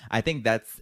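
ordinary delivery

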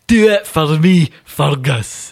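drawn-out Scouse send-up; mock Scouse. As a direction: urge him on in put-on Scouse. Joins mock Scouse fan bit.

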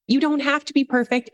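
ordinary delivery

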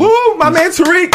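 high, loud whooping cheer; excited cheer. Real, not acted. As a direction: cheer him on like a hype man. Cheering news about Tariq.

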